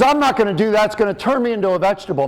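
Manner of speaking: high-pitched indignant refusal, imitated; protesting father. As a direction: play a dad digging in his heels. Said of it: "He says".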